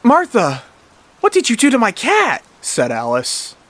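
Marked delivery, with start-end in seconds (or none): 0.0-2.4 high, loud accusing question; acted shocked outcry; aghast. A narrator's reading. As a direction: demand an answer over your dead pet. Alice finds her cat dead.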